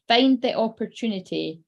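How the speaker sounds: ordinary talk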